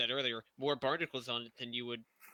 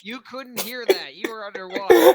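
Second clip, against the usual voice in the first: laughing loud protest, higher; 8 semitones higher, 19 dB louder than usual. Real, not acted. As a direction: laugh through the objection. Teasing retort, laughing.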